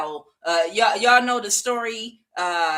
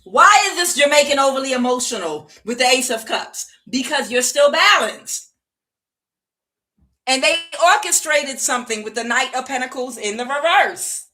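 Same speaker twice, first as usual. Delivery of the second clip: raised, higher, forceful delivery; loud emphatic reading; 6 semitones higher, 7 dB louder than usual. Real, not acted. Reader explaining the spell caster's upset, loud and high. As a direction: call the cards out with force. Heated reading after laughing fit.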